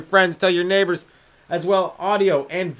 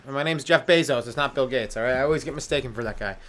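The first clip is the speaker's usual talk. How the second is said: normal talking voice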